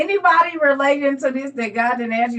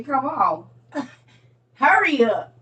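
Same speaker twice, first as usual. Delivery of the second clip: usual speaking voice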